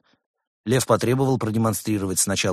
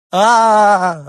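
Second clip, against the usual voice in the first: high, loud cry of pain; pained scream "А-а-а"; 13 semitones higher, 12 dB louder than usual. A narrator's reading. Patient yelling as the doctor presses his ankle, high loud scream. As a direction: shriek as the sore paw is touched. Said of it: "ответил больной".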